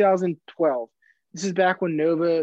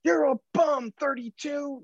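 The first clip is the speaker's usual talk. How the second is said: put-on high, jeering old man; old heckler; 10 semitones higher than usual. Old fan heckling Jay Crowder, high mocking jeer. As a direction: sneer it like a cranky old fan. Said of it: "he's just".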